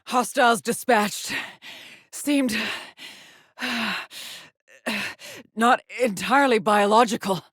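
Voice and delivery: high, loud, catching breath; winded after fighting. Acted. Explorer reporting hostiles dispatched, winded and strained. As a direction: report between heavy breaths after combat.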